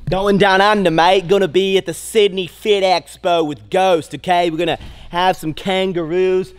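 big mock-Aussie tourist-ad voice; bad Australian accent. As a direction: put on a terrible, over-the-top Australian accent. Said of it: "I'm terrible at accents".

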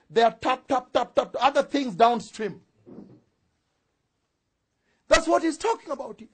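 loud, high-pitched sermon proclamation; ringing pulpit declaration; full-force preaching. Real, not acted.

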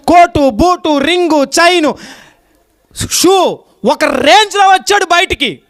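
high, ringing list, phrase by phrase; full-force preaching. Real, not acted. Preacher telling what the younger son lost, full-force preaching. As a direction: proclaim each loss to the whole congregation.

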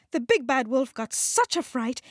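ordinary delivery

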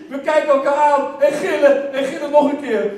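ordinary talk, nothing marked

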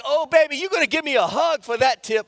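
put-on high, loud, gushing Oh baby; server's voice. Server running down the terminal toward him, high and loud gushing. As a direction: call out big and happy like the server sprinting up. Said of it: "she's like".

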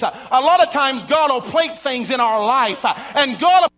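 loud, rising sermon cadence building to a shout; full-force preaching. Acted. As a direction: preach it up to the rafters.

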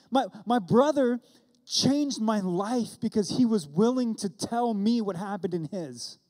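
fervent pulpit preaching; preaching at full force. Acted. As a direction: drive the message home to the congregation.

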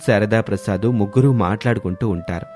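plain reading voice

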